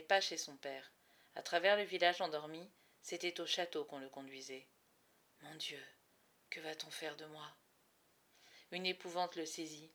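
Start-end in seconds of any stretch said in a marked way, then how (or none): 5.4-7.5 frightened. Acted. Boy terrified, led to castle.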